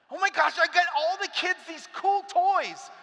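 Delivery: high, loud, put-on giddy voice; giddy new teacher. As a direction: bubble over about the cool toys.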